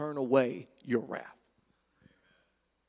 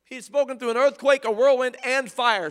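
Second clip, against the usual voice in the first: loud, higher, hammering preacher; booming revival preaching; preaching at full force; 4 semitones higher, 7 dB louder than usual. Acted. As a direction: thunder out the whirlwind and fire. Thunders through the manifestations.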